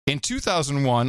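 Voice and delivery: ordinary talk, nothing marked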